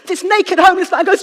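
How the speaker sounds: very loud, high build-up to a scream; shouting. Real, not acted. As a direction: shout the narration at the listeners. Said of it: "me shouting at you".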